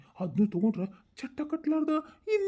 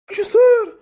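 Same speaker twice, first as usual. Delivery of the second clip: much higher, louder wail; high, loud frustrated cry; exasperated cry; 9 semitones higher, 13 dB louder than usual. Acted. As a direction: cry out at wits' end. Exasperated outburst.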